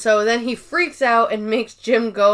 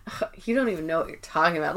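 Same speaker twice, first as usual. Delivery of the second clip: quick, much softer and lower scornful brush-off; bored teen scorn; 6 semitones lower, 8 dB quieter, much faster than usual. Retold or imitated. Ryan brushing off Dwight, quick low scornful mutter. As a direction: sneer it fast under the breath like a bored teen.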